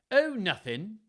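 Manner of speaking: high, loud, offhand character voice; Edmund's feigned innocence. A narrator's reading. Son hides a paper from father, high-pitched fake innocence.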